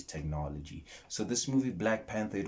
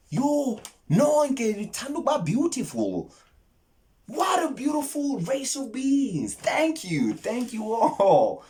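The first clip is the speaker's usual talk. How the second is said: much higher, louder, drawn-out adoring voice; gushing praise; 14 semitones higher, 7 dB louder, slower than usual. Acted. Thanking his viewers, squealing with delight. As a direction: gush over them in a sky-high voice.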